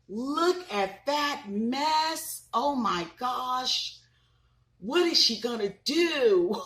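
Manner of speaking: high, slow gasping "Look at that mess"; surprised. Acted. Mother reacting to paint spilling on the sisters, mock shock. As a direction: act shocked for the kids.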